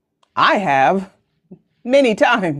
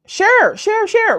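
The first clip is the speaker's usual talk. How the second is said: singsong urging; 11 semitones higher, slower than usual. Real, not acted. Urging viewers to share.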